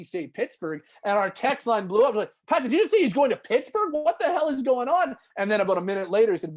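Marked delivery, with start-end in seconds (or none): 2.5-5.2 high, loud disbelieving outburst; high and loud, in mock shock; incredulous listeners. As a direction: yell it like a stunned fan texting in. Text line blew up.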